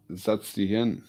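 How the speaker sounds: loud German "setz dich hin"; German command. Shown as a demonstration. Owner commanding dog in German, loud. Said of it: "I'll pull out the German".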